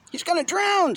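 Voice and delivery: put-on very high boy voice, alarmed; frightened child. A narrator's reading. Boy warns his family, high and frightened. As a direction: cry it out like a scared kid.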